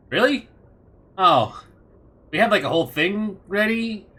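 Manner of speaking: high, loud, disappointed questioning; deflated villain, taken aback. Acted. Villain learns his enemies are already dead, incredulous letdown. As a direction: start high in disbelief, then sink. Villain's big moment is spoiled.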